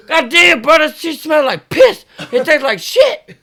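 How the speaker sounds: mock disgusted shout. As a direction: spit it out like the taste hit him. Friend reacts to tasting the bottle.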